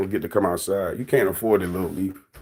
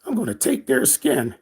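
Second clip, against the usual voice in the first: mock villain quote; 9 semitones higher than usual. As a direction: put on a sneaky schemer voice. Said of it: "Shim was like".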